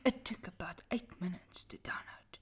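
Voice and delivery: soft spooky whisper; dramatic whisper. Acted. Reading a creepy story to friends, whispered dramatically. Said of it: "Dramatic voice again".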